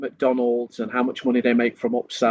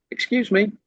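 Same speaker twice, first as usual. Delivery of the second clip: polite excuse me; 8 semitones higher than usual. Shown as a demonstration. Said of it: "it should just be".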